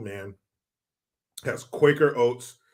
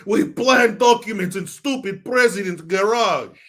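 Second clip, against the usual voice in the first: put-on Russian accent; 5 semitones higher, 6 dB louder than usual. Reporting back to Putin.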